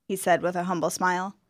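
plain reading voice

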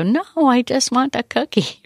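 put-on child's voice. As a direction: play the kid insisting on the cookie. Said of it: "And then they go".

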